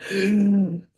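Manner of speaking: imitated sharp gasp of shock. Retelling how the client got so shook, imitated shocked gasp. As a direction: gasp as if badly shaken. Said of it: "like, she was like".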